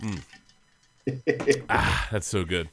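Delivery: talking around a sip; just took a sip, mouth wet; while sipping a drink. Real, not acted. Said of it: "Let me take a sip first".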